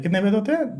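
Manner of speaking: ordinary talk, nothing marked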